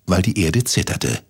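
usual speaking voice

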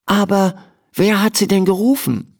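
put-on a shy girl introduces herself; Emma, girl voice. A narrator's reading. Emma tells the doctor her name, shy.

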